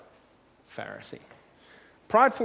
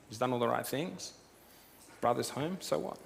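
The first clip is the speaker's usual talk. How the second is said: unimpressed, softly spoken shrug; quiet, indifferent "so what" attitude; dismissive; 2 semitones lower, 7 dB quieter than usual. Acted. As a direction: toss it off as no news at all. Voicing the unimpressed, resentful older brother.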